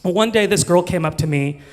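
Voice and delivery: ordinary talk, nothing marked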